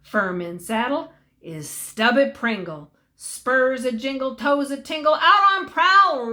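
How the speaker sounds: usual speaking voice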